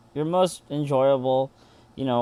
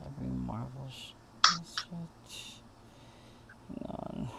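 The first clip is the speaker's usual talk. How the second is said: whispered to self; 13 dB quieter than usual. Real, not acted. Muttering while searching notes.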